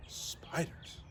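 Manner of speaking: hushed whisper of the word. Acted. Teacher echoing the astrologer's demand for spiders, whispered. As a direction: breathe it out as a spooky aside. Echoes spiders as spooky aside.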